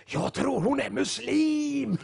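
high, slow, mock-whispered aside; loud stage whisper. Sister leaning in to tell the pastor about the woman opposite, mock loud whisper. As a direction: imitate a hard-of-hearing old lady whispering too loudly. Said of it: "hon viskar ganska högt".

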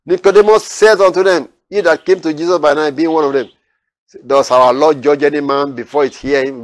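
loud, lifted Bible reading; preacher reading the Gospel aloud to his listeners, declaiming; scripture reading. Acted. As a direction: proclaim the verses to the congregation. Reads scripture verses aloud.

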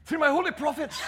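put-on high, theatrical old-English God; King James voice. God chides the church over the prophets, hammed-up King James voice. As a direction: declaim it like a mock-Shakespearean deity. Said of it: "in his King James voice going".

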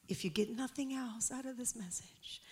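soft unvoiced whisper. Real, not acted. Preacher leaning in to the congregation, whispering. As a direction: lean in and whisper it.

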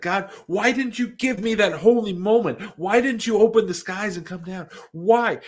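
a bitter, loud plea to God; angry outcry at God. Retold or imitated. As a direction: hurl the questions at the sky. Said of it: "I got mad".

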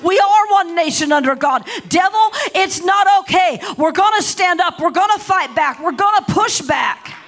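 loud, high preaching at full force; forceful, defiant battle cry from the pulpit; indignant rebuke. Acted. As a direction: rebuke the devil with mounting defiance. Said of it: "taking offense and saying".

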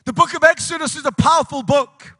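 declamatory sermon delivery; emphatic preaching. Real, not acted. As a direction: proclaim it in punched phrases to the congregation. Resumes preaching at force.

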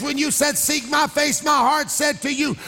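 full-force preaching. Real, not acted. Preacher urging the congregation to ooze, full-force preaching. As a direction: rouse the room to make noise.

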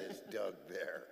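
soft, low echo of the question; quoting the caller. Retold or imitated. Man quotes her phone question back, soft and low. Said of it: "You said".

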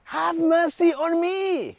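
high, slow drawn-out cry for mercy; mock shout. Preacher voicing Bartimaeus calling to Jesus, high slow cry. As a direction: stretch the plea high like a blind beggar calling out. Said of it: "he shouted".

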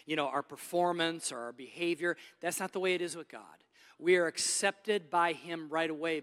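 usual speaking voice

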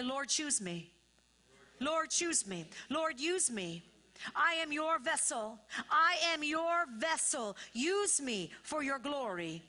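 loud, high declaration, slowing toward the end; preaching call-and-response. Real, not acted. Preacher gives the church a prayer to repeat, fervent call-and-response. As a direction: lead the congregation in a fervent declaration.

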